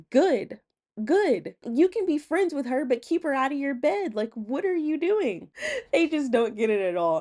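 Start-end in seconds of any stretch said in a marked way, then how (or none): 0.1-5.5 higher exasperated mom voice; mimicked mom. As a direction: scold like a fed-up mother. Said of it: "And the mom's like".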